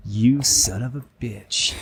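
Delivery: slow, drawn-out accusation; mock confrontation. Someone walks up to Chief Peaches, mock outrage. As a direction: stretch it out like a staged insult. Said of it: "walk up to Chief Peaches and be like".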